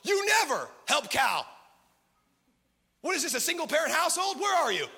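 loud, high, fast mock scolding; hammed-up angry nagging; nagging wife. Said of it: "her going".

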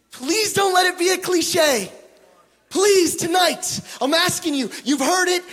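high, loud urgent plea; pleading shout. Real, not acted. Preacher begging the crowd to take it seriously, shouting. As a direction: cry out to them not to shrug it off.

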